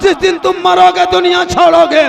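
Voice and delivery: high, loud impassioned sermon delivery; full-force preaching. Acted. Preacher voices God addressing His servants, full-force exhortation. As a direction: thunder out God's command to His servants.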